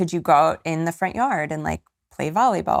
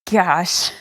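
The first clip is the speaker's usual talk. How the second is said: emotional laugh; 2 semitones higher, 4 dB louder than usual. Real, not acted. Coach overcome mid-lesson, teary laugh. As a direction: laugh off the tears. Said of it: "This gets me emotional".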